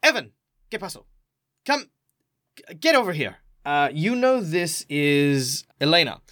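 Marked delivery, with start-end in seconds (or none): 0.0-3.4 lightly voiced carer calling a kid in; higher-pitched summons from indoors; woman calling out. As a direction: call the boy over. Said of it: "suddenly call out".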